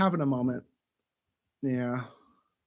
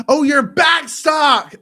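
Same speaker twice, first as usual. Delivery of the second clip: high, loud, drawn-out "oh"; loud realisation; 9 semitones higher, 10 dB louder, much slower than usual. Real, not acted. Finally understanding what back stock means, loud realisation. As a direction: call it out as it finally clicks. Sudden realisation of misheard word.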